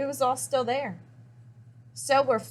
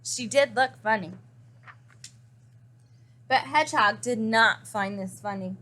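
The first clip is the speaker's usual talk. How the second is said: usual speaking voice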